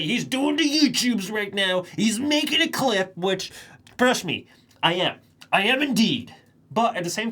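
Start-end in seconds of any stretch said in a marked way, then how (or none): 0.0-3.2 mock viewer remark. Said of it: "People might say".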